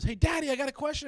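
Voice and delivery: put-on high, loud kid voice; young son. Youngest son comes to dad with a question, kid voice. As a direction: pipe up like a child calling Dad. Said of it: "He came out of the bedroom".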